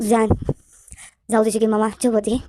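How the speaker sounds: usual speaking voice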